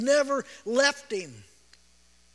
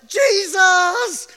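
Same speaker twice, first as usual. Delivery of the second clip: desperate shouted cry; yell; 7 semitones higher, 9 dB louder than usual. Retold or imitated. Listeners in trouble calling on Jesus, loud yell. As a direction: yell it like you're going under.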